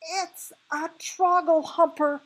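high, loud, drawn-out yell. Shown as a demonstration. Reader showing how the BFG would have yelled, yelling. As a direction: yell it out in alarm. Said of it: "he probably would have yelled".

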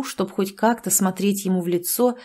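ordinary delivery

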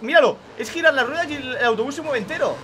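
usual speaking voice

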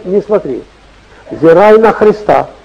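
normal talking voice

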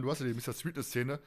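ordinary delivery

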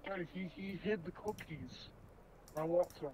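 normal talking voice

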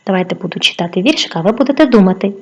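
ordinary delivery

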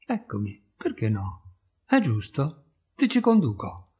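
ordinary delivery

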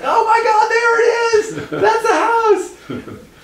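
mock-panicked falsetto scream, very high and loud; hammed-up girlish screech; hysterical shriek. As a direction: imitate a shrieking friend, drawing out the last words. Friend spots house, panicking, comically retold.

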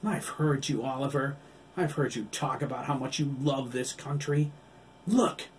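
bitter rant ending in a shout; furious rant. Acted. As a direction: accuse him, then explode. Billy rages at Oliver.

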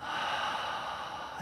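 long soft airy sigh; slow audible sigh of release; cleansing breath out. Shown as a demonstration. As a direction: let out a long, relaxing exhale. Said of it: "cleansing, deep breath".

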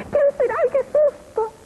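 put-on high crying shriek of a frightened old lady; fearful scream. Old woman answering the knock, high crying scream. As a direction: wail it through the door in fright. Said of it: "le contesta un grito".

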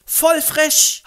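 sehr hoch, laut, prollig; Straßengöre. Acted. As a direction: patzige Göre im Jugendslang. Eliza empört. (hohe, laute Prollstimme)

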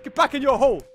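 scolding a dog. Pretend. Player scolds Freddy like a naughty dog, stern. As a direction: tell him off sharply.

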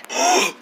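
high startled gasp; sharp gasp. Acted. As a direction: gasp in sudden alarm. Startled gasp before grabbing.

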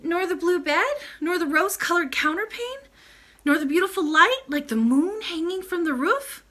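put-on high, loud, insistent child; urgent, disbelieving questioning; incredulous princess.

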